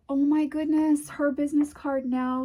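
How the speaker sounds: delighted high exclamation; excited. Real, not acted. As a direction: gasp of real delight. Delighted by the business card.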